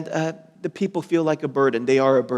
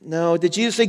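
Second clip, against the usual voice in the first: ordinary delivery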